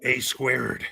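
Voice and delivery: a lightly voiced coach, leaning back; coach impression. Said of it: "you do a great person, uh, impersonation".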